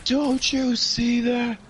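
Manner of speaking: high, slow hammed-up prophet voice; mock dramatic speech. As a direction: drag it out like a doomsayer in a bad movie.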